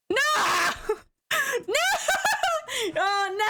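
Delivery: high-pitched no through laughter; laughing wail. Real, not acted. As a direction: cry out in laughing dismay. Losing the game, laughing.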